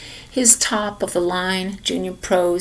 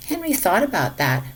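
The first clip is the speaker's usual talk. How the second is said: usual speaking voice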